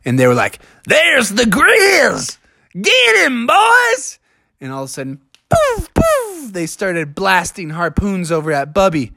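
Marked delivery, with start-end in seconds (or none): 0.9-4.2 high, drawn-out hunter's call; storybook hunter raising the cry; excited hunters. A narrator's reading. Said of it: "the hunters in the boat". 5.5-6.5 high-pitched "poof" firing noises; airy cartoon blast sounds; harpoon blasts. A narrator's reading. Imitates harpoons being fired.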